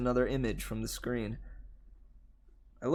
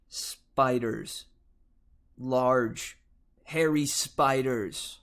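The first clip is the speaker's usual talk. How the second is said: slow ominous; 5 dB louder, much slower than usual. Acted. Scaring her awake with spiders.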